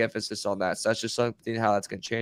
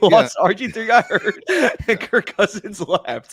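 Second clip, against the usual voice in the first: laughing; 8 semitones higher, 5 dB louder than usual. Real, not acted. Laughing at Washington's QB mess.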